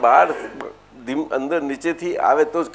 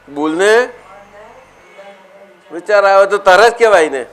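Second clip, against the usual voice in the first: usual speaking voice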